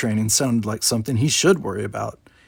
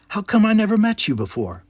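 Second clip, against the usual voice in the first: put-on young cadet; 10 semitones higher, 3 dB louder than usual. Geordi voiced.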